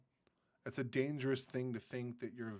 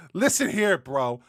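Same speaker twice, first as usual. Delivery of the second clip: much louder, hammed-up indignant bro; mock offended American; 8 semitones higher, 14 dB louder than usual. Playing an offended American, he blusters louder at the viewers.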